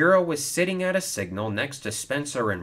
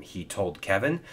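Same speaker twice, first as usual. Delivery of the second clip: plain reading voice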